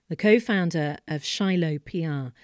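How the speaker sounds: ordinary delivery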